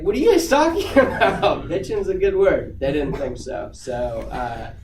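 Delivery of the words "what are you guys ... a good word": loud, high-pitched mock disbelief, laughing; retold baffled protest. Speaker defending a word to offended coworkers, baffled protest. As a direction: push back in amused, exaggerated bafflement.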